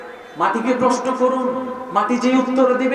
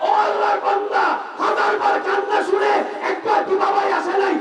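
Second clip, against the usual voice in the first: rising sermon cry; 8 semitones higher than usual. Acted. Preacher's rising rhetorical challenge.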